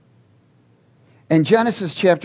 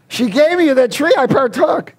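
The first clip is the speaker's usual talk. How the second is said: much higher, louder, whining excuse; whiny Adam; 11 semitones higher, 4 dB louder than usual. Acted. Adam blames the woman before the Lord, whining high. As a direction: point the finger like a caught child.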